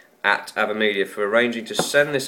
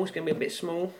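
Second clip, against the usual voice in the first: soft London accent, higher; 6 semitones higher, 10 dB quieter, faster than usual. Shown as a demonstration. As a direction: put on a Cockney voice. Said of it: "small in a London accent".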